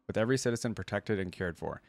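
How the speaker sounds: normal talking voice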